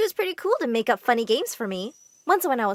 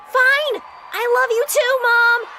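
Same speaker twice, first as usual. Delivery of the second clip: high, loud, reluctant yell; forced shout; 10 semitones higher, 6 dB louder, slower than usual. Acted. Kelly shouts back to her mom across the field, reluctant loud yell. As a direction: yell it back just to end it.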